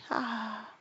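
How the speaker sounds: whispered sigh ah. Acted. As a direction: breathe out a sad sigh. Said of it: "with a deep sigh".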